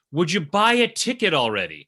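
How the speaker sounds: an impatient parent finally snapping; exasperated God. Retold or imitated.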